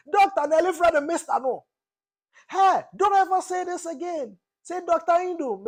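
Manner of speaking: shrill-voiced rebuke, mocking the auntie; scolding auntie. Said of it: "You know they are fancies".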